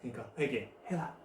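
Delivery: low, hushed invitation; soft urging. Acted. Jesus calling people to repent, soft and low. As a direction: drop right down and urge softly.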